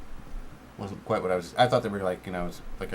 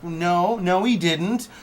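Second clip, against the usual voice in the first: ranting; 7 semitones higher, 6 dB louder than usual. Acted. Ranting at the movie.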